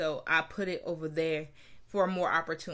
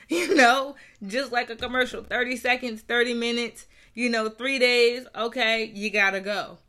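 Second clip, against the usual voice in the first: laughing; 4 semitones higher, 7 dB louder than usual. Real, not acted. Lively aside, laughing.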